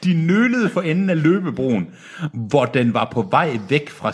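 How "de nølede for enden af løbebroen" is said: "nølede for enden af løbebroen" pitched high; lifted, deliberate stress through "nølede for enden"; emphatic repeat. A narrator's reading. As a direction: repeat "de nølede" pointedly for a baffled listener. Repeats it for baffled listener.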